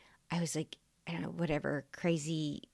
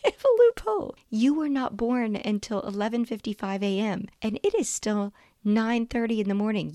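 much higher and louder, gleeful; excited loophole; 6 semitones higher, 6 dB louder than usual. Retold or imitated. Mother telling her son about a loophole, high, loud and gleeful. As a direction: triumphantly announce the trick. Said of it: "I was like".

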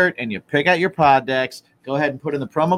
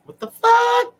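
squeaky mock shock; 4 dB louder, slower than usual. Reacting to deck choice.

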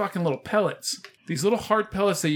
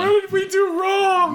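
high, loud, slow lament; mock the parents' lament; mock despairing parent; 14 semitones higher, 5 dB louder, slower than usual.

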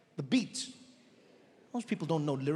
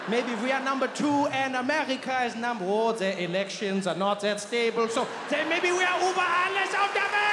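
building to triumphant shout; 5 semitones higher than usual. Acted. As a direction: climb to a triumphant anthem yell. Escalating to anthem shout.

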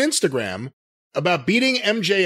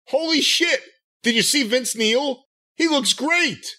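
higher-pitched voice of impressed fans; amazed reaction; 7 semitones higher than usual. Retold or imitated. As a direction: act out people raving about his new look. Imagined amazed public reaction.

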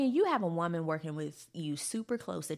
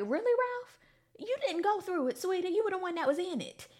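sassy retort; 10 semitones higher than usual. Retold or imitated.

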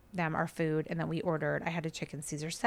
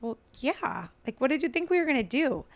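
annoyed retort; 6 semitones higher than usual. Retold or imitated.

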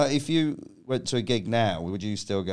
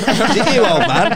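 much higher, louder mock-menacing shout; hammed-up challenge; 9 semitones higher, 11 dB louder than usual. Waving chairs at old men in the crowd, mock shout. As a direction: bellow it at the old hecklers.

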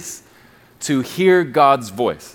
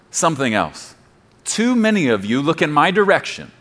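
ordinary delivery

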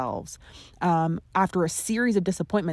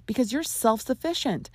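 ordinary talk, nothing marked

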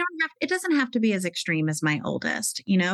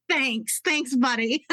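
thanks through real laughter, higher pitch; laughing thanks; 5 semitones higher, slower than usual. Real, not acted. To her teenage son after his joke, laughing. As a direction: laugh off the son's jab with thanks.

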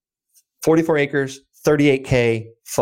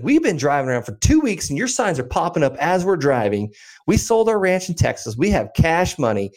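higher-pitched retold Texan buyer; Texan accent; 7 semitones higher than usual. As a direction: play an eager Texan caller, pitched up. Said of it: "like, nice Texan accent".

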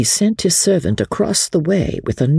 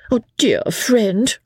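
high, slow, sugary appeal; angelic sweetness; 7 semitones higher, slower than usual. A narrator's reading. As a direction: coo it with put-on angelic sweetness. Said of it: "with angelic sweetness".

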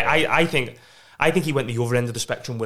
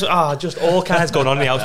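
ordinary talk

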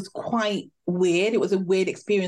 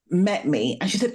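ordinary talk

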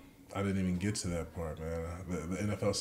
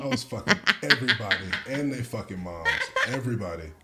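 laughing boast; 7 semitones higher, 8 dB louder, faster than usual. Real, not acted. Boasting about wild Tinder phase.

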